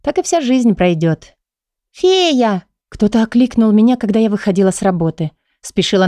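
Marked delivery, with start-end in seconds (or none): 1.9-2.6 put-on high child's call. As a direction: call out like a little girl. Child calls out on street.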